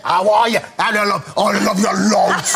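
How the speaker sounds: Scouse phone greeting, put on; imitated Scouse. Actor mimicking his colleague on the phone to family, put-on Scouse. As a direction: gush down the phone in mock Scouse. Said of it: "teach Mister Hank Scouse".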